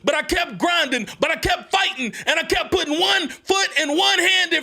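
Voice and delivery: full-force motivational shout; fiery preaching. Acted. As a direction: preach it like a rallying cry.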